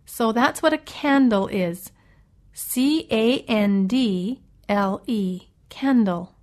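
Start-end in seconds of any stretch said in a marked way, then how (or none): 2.5-5.5 spelled out. Shown as a demonstration. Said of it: "spells the word aloud".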